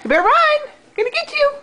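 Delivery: high, squeaky mock threat; playful chase voice. Real, not acted. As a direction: tease him into running. Chasing game with baby.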